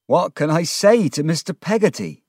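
high, loud, despairing question; anguished plea. A narrator's reading. Ham dreads telling Mister Peggotty, anguished. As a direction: plead helplessly, pitched high.

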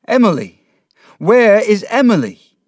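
loud, high cry of alarm; frantic shout. A narrator's reading. Mister Peggotty searches for Emily, frantic shouting. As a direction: shout desperately for the missing girl. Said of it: "he shouted".

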